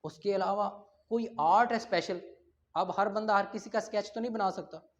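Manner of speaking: usual speaking voice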